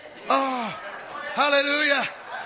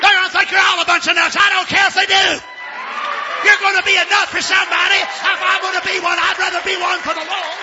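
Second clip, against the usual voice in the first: loud, high, shouted preaching; fiery revival preaching; preaching at full force; 8 semitones higher, 5 dB louder than usual. Acted. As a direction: preach it hard to the congregation.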